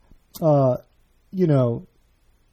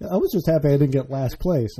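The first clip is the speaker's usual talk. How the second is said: usual speaking voice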